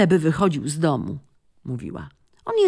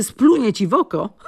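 laughing; 10 semitones higher, 4 dB louder than usual. Acted. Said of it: "dorzuciła Bobby".

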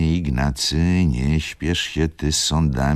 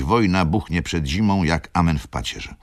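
ordinary delivery

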